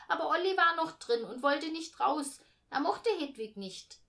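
put-on high, loud child voice; brash kid's objection. A narrator's reading. Boy corrects his sister about the baby's bed, loud and brash. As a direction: chip in loudly to correct.

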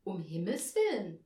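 measured echo at everyday pitch; confirming. A narrator's reading. Angel echoing the hostess's words, composed confirmation. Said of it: "bestätigte Aurelius".

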